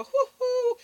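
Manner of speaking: high loud whoop; excited whoop. Real, not acted. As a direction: hoot out loud in delight. Shout out to baby father.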